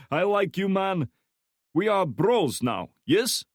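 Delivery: put-on high, loud thick Slavic accent; heavy Slavic accent. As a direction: Slavic thug voice, friendly menace. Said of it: "speaking in a heavy Slavic accent".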